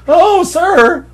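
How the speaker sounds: very high, loud cartoon squeal; lisping cartoon nerd. Shown as a demonstration. Comic demonstrating the voice he meant, squealed cartoon nerd. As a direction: squeal it like an over-eager cartoon geek. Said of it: "I wanted to give a, like, a premature".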